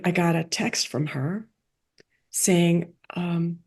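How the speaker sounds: ordinary delivery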